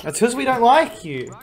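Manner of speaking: high, loud teasing jab; taunting a friend in a high voice; mock taunt. Real, not acted. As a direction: needle him playfully. Teasing a teammate.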